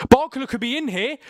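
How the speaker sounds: a streamer calls the breakaway to chat, loud urgent excitement; excited. Real, not acted.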